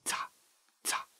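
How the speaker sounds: voiceless whispered ザ, very soft; ザ in a hushed, careful whisper. Shown as a demonstration. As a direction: whisper ザ as a sound demonstration. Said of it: "ザをささやき声で発音すると".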